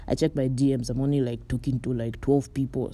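ordinary talk, nothing marked

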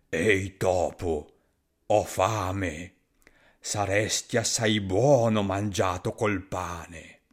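low, slow monster voice; hungry monster. Acted. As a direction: rumble it like a big hungry beast. Gruffalo threatens mouse.

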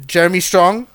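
ordinary talk, nothing marked

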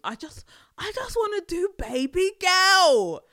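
loud, high pleading whine; exasperated plea. Real, not acted. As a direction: throw your hands up and plead.

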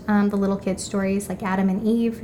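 ordinary talk, nothing marked

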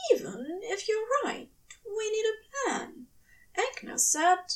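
a prim angel pressing the point; high, firm angel voice; insistent angel. A narrator's reading. Aziraphale voiced in story.

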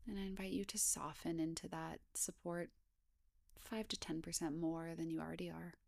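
hushed, even body-scan voice with long pauses; guided meditation. Acted. Guide inviting listeners to scan down the body, guided meditation. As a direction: gently lead a meditation, unhurried, leaving space between phrases.

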